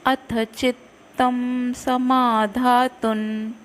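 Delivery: slow, deliberate reading of the verse; shloka recitation. Shown as a demonstration. Teacher reading the new verse line slowly for the class. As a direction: lay out each syllable for students. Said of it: "नौवा श्लोक".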